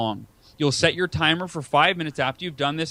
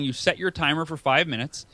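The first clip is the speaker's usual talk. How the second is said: usual speaking voice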